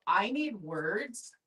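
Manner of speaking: ordinary delivery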